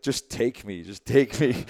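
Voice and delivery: swooning plea. Retold or imitated. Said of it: "she got down on both knees".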